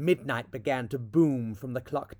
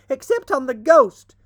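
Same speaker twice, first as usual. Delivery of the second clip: even louder high shriek, stress on ghost; 10 semitones higher, 12 dB louder than usual. A narrator's reading. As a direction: top the first shout, hit ghost. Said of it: "shrieked the twins".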